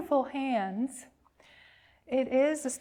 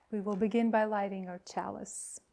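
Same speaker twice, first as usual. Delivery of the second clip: normal talking voice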